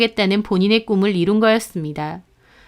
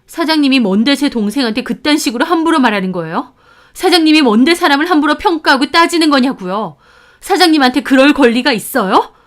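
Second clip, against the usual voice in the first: rising indignant tirade, louder each line; furious demanding; 5 semitones higher, 5 dB louder than usual. Acted. Sister defending her sibling, rising furious demands. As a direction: build up the outrage question by question. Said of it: "화가 머리 끝까지 나서 따졌습니다".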